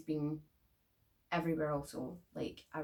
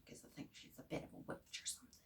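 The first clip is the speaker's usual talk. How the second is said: hushed, breathless aside; whispered aside; 15 dB quieter, faster than usual. Real, not acted. Vlogger whispers to viewers that the woman is a witch. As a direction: drop to a quick whisper. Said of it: "whispered aside".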